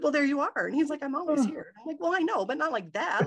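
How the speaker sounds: flustered. Retold or imitated. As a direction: talk back while still shaken. Talking back to God, startled.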